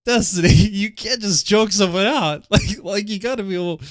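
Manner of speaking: high, breathless laughter through the words; can barely get it out for laughing. Real, not acted. Laughing while reading about choking.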